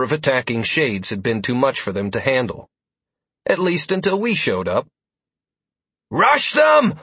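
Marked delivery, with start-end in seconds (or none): 6.1-6.9 loud commanding battle shout. A narrator's reading. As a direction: yell the order to charge. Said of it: "Alistair shouted".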